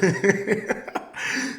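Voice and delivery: unvoiced rising laugh; breathy laugh. Real, not acted. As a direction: let a breathy laugh escape. Laughs at own story.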